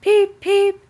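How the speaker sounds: high, loud peeping; little engine whistle. A narrator's reading. Thomas whistles back to Gordon, high and loud. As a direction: peep it like a small whistle. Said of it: "Thomas always whistles".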